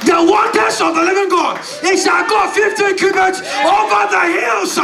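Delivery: prophesy with full force over the crowd; prophetic declaration. Acted. Preacher declaring poverty drowned to church, shouting.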